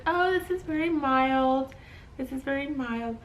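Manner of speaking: high, slow mock-soothing nurse; dismissive nurse. Nurse checking contractions, lightly dismissive.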